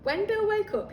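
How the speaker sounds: ordinary delivery, nothing marked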